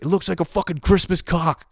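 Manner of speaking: high-pitched mock outburst from Dad; exasperated dad. Dad sees the failed cover-up, high mock-exasperated outburst. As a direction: imitate a dad's exasperated outcry. Dad's exasperated reaction, acted.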